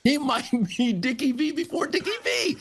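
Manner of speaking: very high, laughing exclamation; laughing mock amazement. Host capping the story of impressed bosses, laughing amazement. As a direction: squeal the praise through a laugh. Joking high punchline of retold praise.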